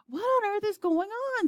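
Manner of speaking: high, startled question; alarmed. Acted. President hearing screams on the loudspeaker, alarmed. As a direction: caught off guard and rattled.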